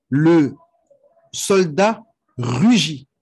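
slow, deliberate translation with spaced words; slow dictation. Shown as a demonstration. Teacher translating the Arabic example for students, slow dictation. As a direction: stretch the words out for students to follow.

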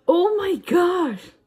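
high, drawn-out astonishment; stretched, stunned shout; amazed exclamation. Real, not acted. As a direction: cry out slowly in amazement. Amazed at lip colour changing.

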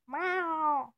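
loud meow with a falling end; demanding kitty meow. Retold or imitated. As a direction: bold cat meow. Imitates the cat.

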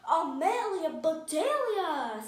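loud, drawn-out astonishment at a name; boy thrilled to recognize a name; amazed recognition. A narrator's reading. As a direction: stretch the name out in loud disbelief. Harry recognizes her famous name.

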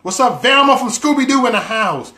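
hype welcome. Real, not acted. Hyping up a stream viewer.

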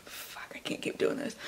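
unvoiced whisper breaking character; whispered aside. Real, not acted. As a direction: drop to a whisper mid-reading. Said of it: "whispered aside laughing".

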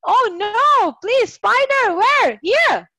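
high, loud alarmed cry at a spider; real jolt of fright, voice jumping up; startled by a spider. Real, not acted. Teacher spots a spider mid-class, high loud alarmed outburst.

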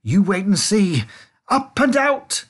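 high, loud, triumphant call; rallying shout, very high at the end; exultant cry. A narrator's reading. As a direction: build to a gleeful holler. Wonka exultant as lift rises.

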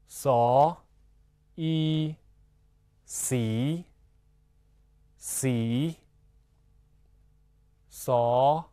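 very slow "so, i, si, si", each held and separate; spelling-out drill. Shown as a demonstration. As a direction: sound out "so", "i", then blend to "si" for learners. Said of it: "自己拼读这三行音节".

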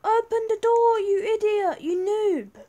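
high, loud furious shout; angry shout. Real, not acted. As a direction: yell it at the guard, fed up. Yelling at guard louder.

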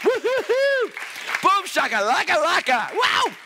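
high, whooping outburst; getting excited. Real, not acted. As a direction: let loose playful, exuberant nonsense syllables. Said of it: "just me getting excited".

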